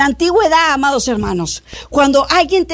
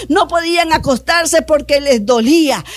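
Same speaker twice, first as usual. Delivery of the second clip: ordinary delivery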